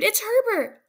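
high, loud screaming cry; a mother hearing her son; desperate scream. A narrator's reading. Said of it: "she screamed".

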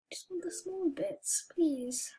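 soft whispered begging; whispered plea. Real, not acted. Player pleading for only small bits, whispering softly. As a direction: beg quietly to be left alone.

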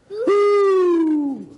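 very high, loud, sustained hoot; piercingly high "hooo"; owl hoot. Acted. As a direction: let out a long owl hoot instead of a crow. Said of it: "but what came out".

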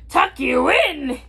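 slow, loud, drawn-out question; pirate bellow. A narrator's reading. Captain answers boy's bedtime request, slow bellow. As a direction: bellow it in disbelief. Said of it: "he bellowed".

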